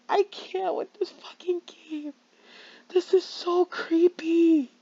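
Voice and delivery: tearful hushed whisper; real shaken crying whisper. Real, not acted. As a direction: whisper it through tears. Horrified by the game.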